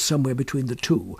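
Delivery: ordinary delivery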